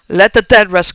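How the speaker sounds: loud pleading cry, a touch of tremble; trembling cry. A narrator's reading. Landlord begs the officer to leave his son's grave, loud pleading cry. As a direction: beg them, voice loud and afraid. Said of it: "cried the landlord with a trembling voice".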